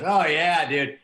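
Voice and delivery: loud, excited agreement; eager driver. Retold or imitated. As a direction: driver jumping at the bait. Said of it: "he goes".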